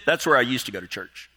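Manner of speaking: low, understated punchline after excited questions; deadpan punchline. Acted. Castaway answers rescuers about the other building, deadpan. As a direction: drop low for a dry payoff.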